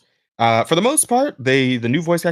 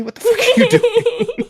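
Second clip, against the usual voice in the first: laughing disbelief; 4 dB louder than usual. Real, not acted. Rosa doing something silly on stream.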